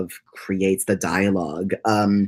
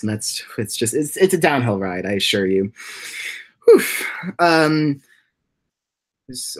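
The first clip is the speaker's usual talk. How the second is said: ordinary talk, nothing marked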